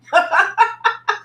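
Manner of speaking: high, rapid giggling laugh. Real, not acted. Vlogger joking to viewers about once being below fifty, laughing. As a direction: burst into a high run of chuckles. Laughs at own age remark.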